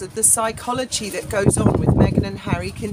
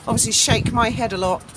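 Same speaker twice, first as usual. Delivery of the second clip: usual speaking voice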